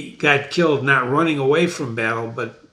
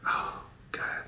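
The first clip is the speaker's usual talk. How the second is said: breathless whispered awe; a hushed real whisper of awe; awed whisper; 13 dB quieter than usual. Real, not acted. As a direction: whisper it, moved by the text. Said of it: "I got goosebumps reading this".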